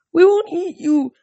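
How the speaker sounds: high, loud wail; wailing horses. A narrator's reading. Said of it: "the horses wailed".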